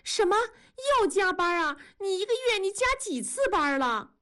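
high, alarmed wife's protest; upset outburst. A narrator's reading. Wife hearing husband works overtime again, upset and high. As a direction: fire back in dismay. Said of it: "文丽一听急了".

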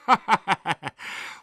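爽朗大笑. A narrator's reading. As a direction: laugh out openly and heartily. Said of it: "狄景辉爽朗地笑道". (高声爽朗地笑)

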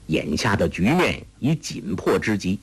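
ordinary delivery, nothing marked

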